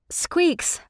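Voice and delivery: high, pleading cry of a name; crying out to the stuck griffin; cried out. A narrator's reading. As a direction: call the name sharply up high. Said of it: "I cried".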